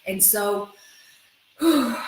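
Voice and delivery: usual speaking voice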